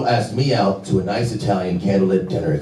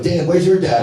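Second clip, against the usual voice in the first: put-on kids asking; 9 semitones higher than usual. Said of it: "All the kids were like".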